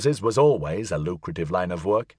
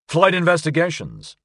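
higher, projected phone greeting; raised voice; 7 semitones higher, 3 dB louder than usual. A narrator's reading. Floyd answering the phone over a passing train, voice raised. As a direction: lift the voice a little over background rumble. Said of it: "raising his voice above the rumbling passage".

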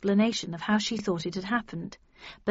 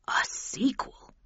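hushed, slow, wondering echo; dreamy; 4 semitones higher, 3 dB quieter, slower than usual. A narrator's reading. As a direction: breathe it out slowly like a daydream. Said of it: "with a sort of dreamy look".

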